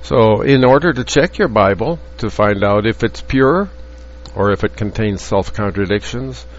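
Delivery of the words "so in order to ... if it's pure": normal talking voice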